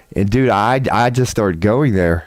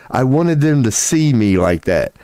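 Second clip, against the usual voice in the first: ordinary talk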